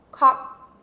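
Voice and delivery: "cock" loud, short, stopped in the throat; fast throaty cock. Shown as a demonstration. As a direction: bark "cock" out quickly from the back of the throat. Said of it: "It has to come from the back of the throat".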